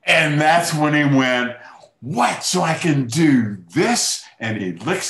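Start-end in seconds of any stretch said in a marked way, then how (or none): 2.0-4.2 higher and louder, a retold "What?" then a slow "So I can do this?"; acting out Kirk suddenly getting the idea; retelling a friend's delighted surprise; surprised realization.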